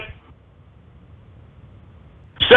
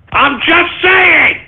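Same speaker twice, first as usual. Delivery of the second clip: mock indignant shout; 8 semitones higher, much slower than usual. Host insists loudly on his joke.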